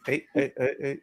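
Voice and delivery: usual speaking voice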